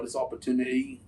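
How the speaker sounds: faint, quiet attempt at a British accent; a half-hearted British accent, like James Bond; mock British accent. Host jokingly copying the guest's British accent, quiet and half-hearted. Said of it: "Jordan gonna have me talking like that".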